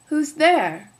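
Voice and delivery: wolf posing as granny; loud disguised wolf; big wolf voice. Acted. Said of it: "the big voice of the wolf".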